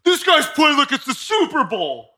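high, slow, mocking freaked-out coach voice; mock angry quarterback. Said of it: "He's like".

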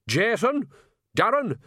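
put-on high, brisk order from an old witch; commanding. A narrator's reading. Mother ordering her sons along, commanding. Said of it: "Nanny commanded".

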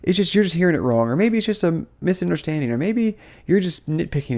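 imitated objectors. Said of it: "People, people are like".

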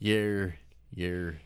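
"Year" ending in a strong R; you with an R. Shown as a demonstration. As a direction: put an R on the end of "Year". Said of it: "put an R in there".

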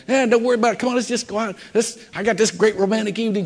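high-pitched, hurried cajoling; coaxing husband. Retold or imitated. Husband pressing his busy wife for a date night, coaxing. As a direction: brush off worries in a high, pleading voice. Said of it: "and I keep going".

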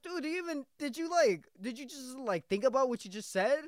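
high-pitched exasperated disbelief; incredulous questioning. Retold or imitated. Employee questioning manager's nonsense, incredulous. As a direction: ask it high, as if baffled by them.

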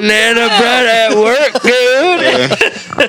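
high, drawn-out bro voice; hammed-up party dude; frat bro. Mocking bro phrase.

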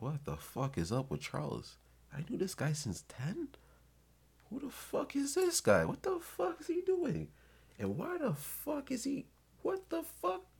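shocked disbelief. Retold or imitated. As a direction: squeak out your shock at a friend gone wild. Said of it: "you're looking at Charles like".

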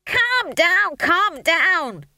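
high, loud frantic scream. A narrator's reading. Stage manager to panicking apprentices, screaming. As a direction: scream for calm, far from calm. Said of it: "screamed Howell".

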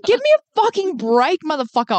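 hammed-up fury; mock exasperated yell. Host reacting to friend's straw demand, mock yell.

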